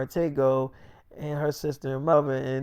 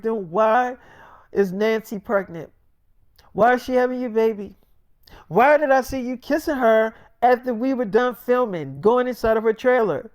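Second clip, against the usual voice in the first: high-pitched, indignant questioning; upset confrontation; 5 semitones higher than usual. Acted. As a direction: demand answers from a cheating lover. Crying girlfriend confronts him, acted.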